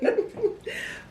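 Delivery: high, airy laughter; trailing high chuckle. Real, not acted. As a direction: keep laughing, tickled by the reminder. Amused by the saving reminder.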